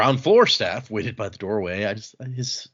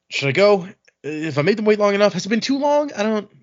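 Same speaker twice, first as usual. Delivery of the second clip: higher-pitched, fretful mock nerves; nervous dithering; 8 semitones higher than usual. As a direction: act out an anxious man second-guessing himself. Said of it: "He's just so nervous".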